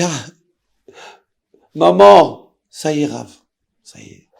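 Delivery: loud broken cry on maman, then fading; retelling a sob of despair; crying out to the rabbi. Retold or imitated. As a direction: voice breaking, then dying away. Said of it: "Et je pleure".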